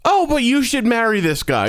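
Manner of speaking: breezy imitation of the mom; mock casual. Mocking the mom's casual tone. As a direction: toss it off lightly like it's nothing. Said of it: "she said it so casually".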